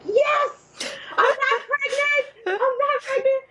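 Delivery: very high, gleeful yes; relieved cheer. Retold or imitated. Mother celebrating a negative test, very high and gleeful. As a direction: squeal with relief at the result.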